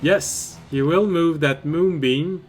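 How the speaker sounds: ordinary talk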